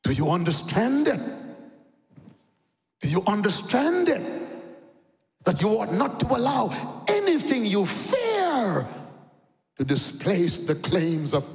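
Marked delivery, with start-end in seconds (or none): none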